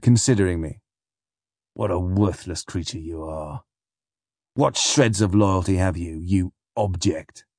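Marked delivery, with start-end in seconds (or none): 1.7-7.5 low, scornful appraisal; quiet contempt. A narrator's reading. Captain considering Flashman, low contempt. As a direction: look down on him with disgust.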